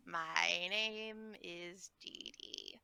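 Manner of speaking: slow, quiet little granny voice; old lady voice. Pretend. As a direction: talk like a frail grandma. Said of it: "how do I do old lady voice".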